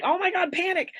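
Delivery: high, loud hammed-up panic; mock freak-out, high and loud. Shown as a demonstration. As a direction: play it like sudden alarm. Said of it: "you don't pull up into like".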